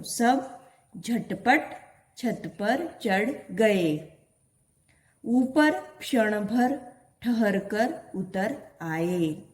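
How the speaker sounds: each word spaced out slowly for the class; slow reading aloud. Shown as a demonstration. Teacher reading a sentence slowly to her pupils. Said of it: "इस प्रकार भी आप वाचन कर सकते हो".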